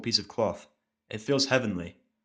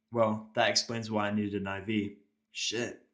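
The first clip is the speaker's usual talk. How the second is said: ordinary delivery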